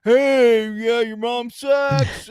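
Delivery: high, loud, slow jeer; a hammed-up high yell; mock parting shout. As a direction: yell it back over your shoulder like a bratty alien. Mocking ET's parting shout.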